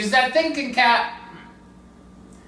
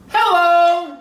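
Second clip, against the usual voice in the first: bright, loud, higher hello; 7 semitones higher, 5 dB louder than usual. Acted. George greeting people on the street, a loud hello. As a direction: call out hello.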